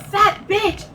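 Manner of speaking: high, incredulous retold echo; indignant echo. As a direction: throw the insult back in disbelief. Retelling her outraged echo of insult.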